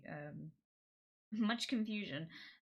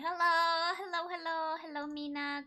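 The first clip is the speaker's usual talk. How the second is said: high greeting to pet; 10 semitones higher than usual. Real, not acted.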